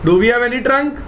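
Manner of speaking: ordinary talk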